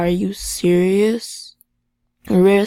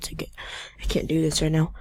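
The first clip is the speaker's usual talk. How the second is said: ordinary talk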